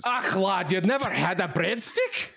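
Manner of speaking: lightly voiced Scottish brogue; Scottish accent. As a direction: say it like a Scottish old-timer. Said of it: "that was Canadian Val".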